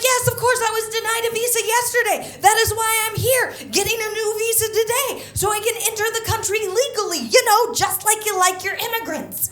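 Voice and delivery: very high, loud, fast rant; mock indignant retort. Traveler answers border agent back, high, loud and indignant. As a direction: rattle it off high and sarcastic.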